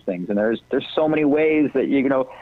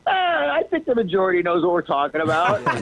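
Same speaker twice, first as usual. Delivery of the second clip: mock dismissive majority; 6 semitones higher than usual. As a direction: wave away a complaint, hammed up. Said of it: "we tend to go like".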